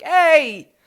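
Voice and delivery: loud high Boston shout, drawn out; Boston accent yell. Acted. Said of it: "yelling in a Boston accent".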